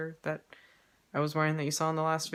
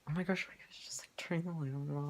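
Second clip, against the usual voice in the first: hushed, half-whispered exasperation; soft, voiceless aside; 4 semitones higher, 7 dB quieter, faster than usual. Real, not acted. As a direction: drop to a quick whisper under your breath. Aside as light gets switched.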